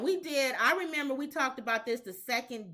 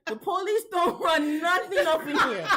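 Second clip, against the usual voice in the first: put-on Chicano gangster accent. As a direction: lay down the prison rules in a Chicano accent. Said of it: "You sound like the guy on".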